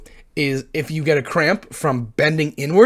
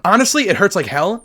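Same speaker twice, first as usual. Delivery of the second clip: usual speaking voice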